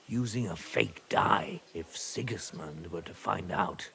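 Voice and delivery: hushed. Acted. Father telling the squire a risky secret, hushed.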